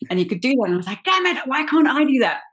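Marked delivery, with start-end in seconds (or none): 1.0-2.4 high-pitched exasperated outburst; mock frustration. Actress envying castmate's accent switching, frustrated. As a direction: exclaim it in comic frustration. Retelling her envy of castmate's skill.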